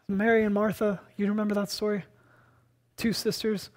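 ordinary talk

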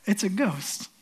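softly, under the breath; understated, quiet alarm; hushed dread. Acted. Fishermen see someone walking on the water, hushed dread. Retells the ghost cry, hushed.

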